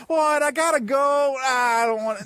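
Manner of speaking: high, put-upon protest; whining reluctant sleeper. Acted. Alien wanting to sleep in on Sunday, high mock whine. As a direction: whine like you're dragged out of bed. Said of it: "aliens are wanting to sleep in on Sunday mornings".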